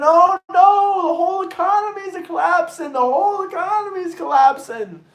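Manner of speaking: hammed-up high alarmed shout; mock panic. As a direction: wail it like the sky is falling. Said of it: "you start screaming".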